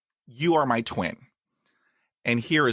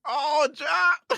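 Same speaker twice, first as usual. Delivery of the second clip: choked up. Real, not acted. Host moved by guest's answer.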